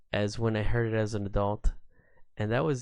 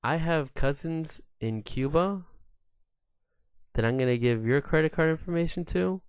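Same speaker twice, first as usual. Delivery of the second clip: indignant sarcasm; 6 semitones higher, 3 dB louder than usual. Retold or imitated. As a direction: throw the accusation back at him. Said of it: "And I go".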